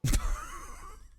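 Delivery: high, wheezing laugh; burst of laughter. Real, not acted. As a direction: crack up in disbelief. Reacting to the odd pronunciation.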